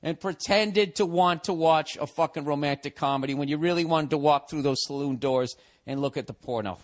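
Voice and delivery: normal talking voice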